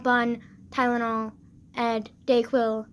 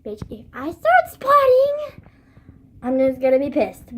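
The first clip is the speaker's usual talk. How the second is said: angry; 6 dB louder than usual. Retold or imitated. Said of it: "I was mad".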